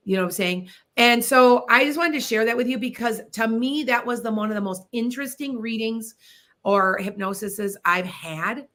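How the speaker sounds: ordinary talk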